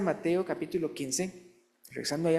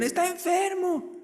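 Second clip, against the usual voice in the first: urgent report. Retold or imitated. Messengers report Lázaro sick.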